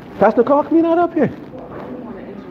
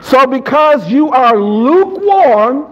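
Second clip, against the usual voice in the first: slow, raised, deliberate Bible reading; emphatic scripture reading; 7 semitones higher, 4 dB louder, slower than usual. Real, not acted. Preacher reading the verse while congregation listens, slow and emphatic. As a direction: declaim the verse, stretching the last word. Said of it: "I'll read it. Y'all just listen".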